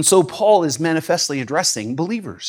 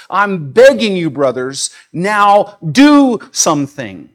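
louder, slow, pleading push on do something; passionate plea; 4 semitones higher, 7 dB louder, slower than usual. Acted. Preacher voicing Paul begging the brothers, loud slow plea. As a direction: beg them to act now.

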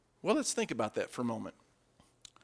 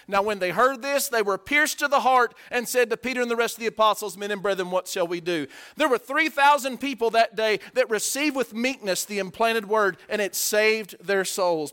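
forceful scripture reading; 6 semitones higher, 6 dB louder than usual. Real, not acted.

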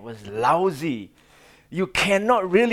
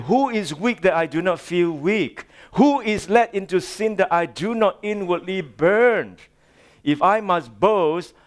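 high, forceful rhetorical questions; impassioned scripture reading; 4 semitones higher, 3 dB louder than usual. Acted. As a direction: challenge the listeners fervently as Paul.

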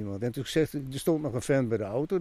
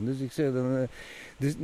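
normal talking voice

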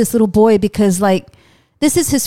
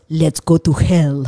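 low slow action hero line; 7 semitones lower, slower than usual. Retold or imitated. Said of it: "Father Amort goes".

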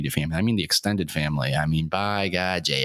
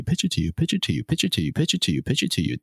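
fake glitch echo; 4 semitones higher, faster than usual. Shown as a demonstration. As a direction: repeat it like a skipping recording. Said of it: "That was just me doing that".

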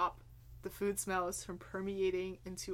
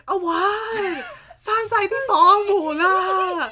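much higher, loud, slow mock yell of a mother; mom yelling; 10 semitones higher, 17 dB louder, slower than usual. Son imitating his mom yelling from the kitchen. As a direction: holler it like a mom at the stove. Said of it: "she's like".

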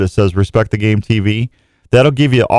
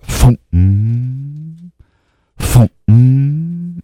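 turkey drumming; much slower than usual. Retold or imitated. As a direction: mimic a strutting gobbler drumming. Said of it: "That spitting and drumming".

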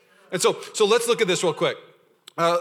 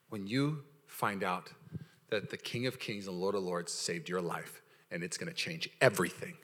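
much lower, softer and slow; hushed and solemn; 10 semitones lower, 12 dB quieter than usual. Real, not acted. Preacher telling the church of salvation in a hushed, low voice. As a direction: drop into a hushed reverent tone.

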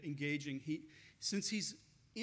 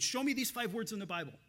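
imitating the challenger, louder and higher; mock-insistent heckler voice; retold angry demand; 5 semitones higher, 6 dB louder than usual. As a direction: push the demand at the listener. Re-voicing the angry man's demand.

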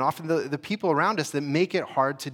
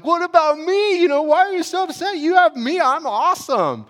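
much higher and louder, whiny, self-centred mock voice; mocking self-absorbed husband; 7 dB louder than usual. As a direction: parody the husband who only thinks of himself. Mocks husband's self-centred comfort.

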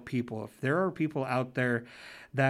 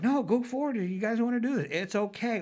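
coaxing parent; 9 semitones higher than usual. Retold or imitated. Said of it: "I was like".

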